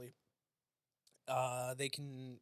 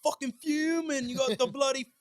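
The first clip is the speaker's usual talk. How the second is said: mock angry British parent; 11 semitones higher, 11 dB louder than usual. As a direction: play an outraged British mother, way over the top.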